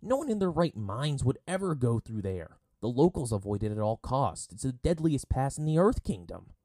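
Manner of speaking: loud, high-pitched outburst; erupting in alarm. A narrator's reading. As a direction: protest loudly in shocked disbelief. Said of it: "Sokka erupted".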